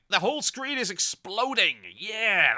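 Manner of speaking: loud, high and thrilled; excited. Real, not acted. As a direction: burst out with glee. Excited about explosions.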